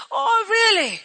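high-pitched scoffing disbelief; hammed-up "oh, really?" derision; mocking ridicule. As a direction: imitate scornful mourners jeering. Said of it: "they ridiculed him".

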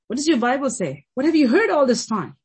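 loud, high-pitched sermon questions; impassioned preaching. Real, not acted. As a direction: preach it hard, question upon question. Preaching with rising urgency.

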